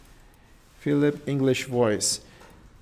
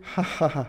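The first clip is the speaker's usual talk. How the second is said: short put-on laugh for a recording; deliberate laugh; 3 dB quieter than usual. Shown as a demonstration. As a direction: laugh on cue. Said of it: "So I will laugh here".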